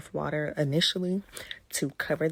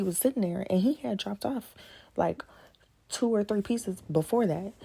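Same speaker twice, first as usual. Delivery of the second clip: excited; 5 semitones higher, 4 dB louder than usual. Real, not acted. Retelling finding the caterpillar.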